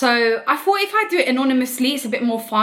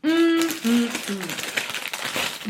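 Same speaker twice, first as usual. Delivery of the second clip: satisfied eating hum; 4 dB quieter, much slower than usual. Real, not acted. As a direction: hum with pleasure at the taste. Tasting food on camera.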